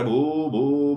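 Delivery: sing-song mock dog barks; barking like a dog. Meenu imagines turning rabid, mock sing-song barking. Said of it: "कुत्र्यासारखं भुंकायला लागणार".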